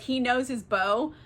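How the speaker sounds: ordinary talk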